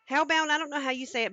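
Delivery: ordinary talk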